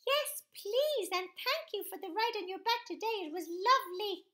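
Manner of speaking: put-on high, loud little child voice; baby thanking the elephant for the ride. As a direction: answer sweetly like a polite toddler. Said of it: "the baby said".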